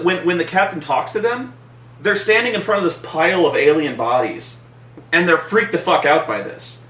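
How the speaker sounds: ordinary talk, nothing marked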